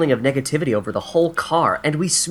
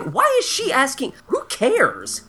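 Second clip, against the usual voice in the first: exasperated; 12 semitones higher, slower than usual. Retold or imitated. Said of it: "So I'm like".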